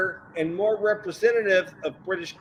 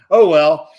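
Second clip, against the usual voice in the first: laughing; 12 dB louder than usual. Real, not acted. Laughing at Kyle.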